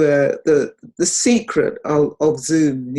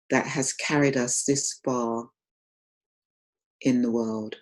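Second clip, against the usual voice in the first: guided meditation. Real, not acted. Teacher guiding online group into gratitude for the body, guided meditation. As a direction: lead them gently inward, phrase by phrase.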